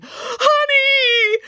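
very high, drawn-out shout; wild, stretched-out yell. A narrator's reading. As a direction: shriek it long and high with greedy delight.

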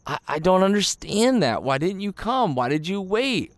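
mild imitation of a let-down friend; reproachful tone. Martha reproaching Jesus for waiting, mildly hurt. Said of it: "you can almost hear it in her tone".

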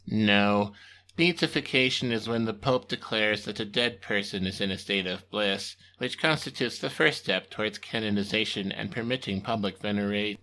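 low, flat patient underling; deadpan assistant. Acted. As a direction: explain it dryly. Timmy explains.

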